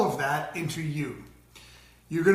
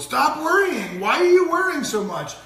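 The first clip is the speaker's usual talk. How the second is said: high, loud anxious parent; acted anxious nagging, pitched up and loud; 10 semitones higher, 7 dB louder than usual. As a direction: fret at a child in a raised, pressured voice. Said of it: "with an anxious tone".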